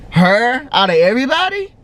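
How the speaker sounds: high, loud-ish disbelieving question; incredulous. Retold or imitated. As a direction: question climbing in disbelief at the end. Retold disbelief at who betrayed her.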